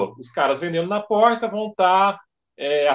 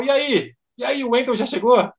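higher-pitched, impatient radio query; mock anxious checking; 7 semitones higher than usual. Organizer radios security about the late guest, anxiously. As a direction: imitate a worried organizer asking for news. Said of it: "Aquela ansiedade monstra".

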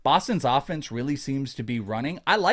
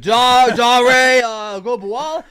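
much higher, louder mock Boston accent on Agunbowale; Boston man on the street; 10 semitones higher, 12 dB louder, slower than usual. Imagined Boston passerby asked to pronounce Agunbowale, mock Boston accent. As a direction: imitate a random Bostonian stumbling over Agunbowale. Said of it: "ten different Boston pronunciations of Dare Agunbowale".

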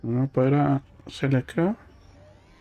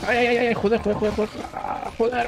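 high panicked yelps of joder; startled cursing; 12 semitones higher than usual. Real, not acted. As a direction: yelp and swear in a sudden fright. Startled in the game.

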